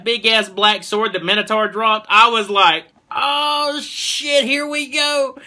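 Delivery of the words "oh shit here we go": hyped reaction. Retold or imitated. Fan reliving the moment he picked up that sword, hyped reaction. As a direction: replay your hype in a high, held-back voice.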